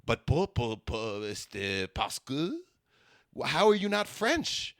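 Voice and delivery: baffled question in a fake Quebec accent; mock Quebecois woman. Quebec woman who can't believe he isn't French, mock French accent. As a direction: plead in caricature French-Canadian. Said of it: "She's like".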